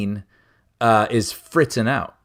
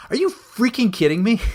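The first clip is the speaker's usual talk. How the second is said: a much higher, exasperated "are you freaking kidding me"; exasperated outburst; 10 semitones higher than usual. Retold or imitated. Fed up with the seat screen cycling movies, exasperated high outburst. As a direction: throw up your hands in disbelief, voice jumping up.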